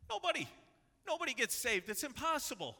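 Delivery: very high, loud, punchy exclamation; emphatic acted answer. Jesus answers the disciples' question, emphatic high acted exclamation. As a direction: fling it out high and loud, hammed-up.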